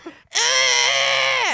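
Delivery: put-on loud, high toddler screech; imitated tantrum scream. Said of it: "he starts throwing tantrums".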